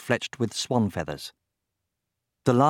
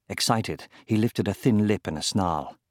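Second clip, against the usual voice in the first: usual speaking voice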